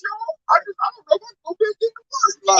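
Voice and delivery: high, strained imitation of someone crying; mock crying. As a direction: ham up a weepy, lost voice. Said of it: "When he was crying".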